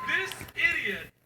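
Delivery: slow, loud, fed up; exasperated. Real, not acted. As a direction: spit it out in disbelief. Exasperated emphasis.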